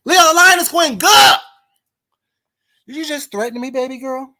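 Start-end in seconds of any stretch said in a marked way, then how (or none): 0.1-1.4 loud high call across the line; shouting over the line to Leo. Real, not acted. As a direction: shout to get Leo's attention. Calling out to Leo.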